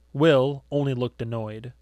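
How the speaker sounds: usual speaking voice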